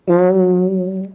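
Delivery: ऊ drawn out with lips rounded; rounded vowel demo. Shown as a demonstration. Teacher showing class lip rounding on ऊ. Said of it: "होठ गोल हो गया कि नहीं हो गया?".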